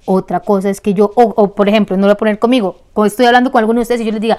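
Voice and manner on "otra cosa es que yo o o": normal talking voice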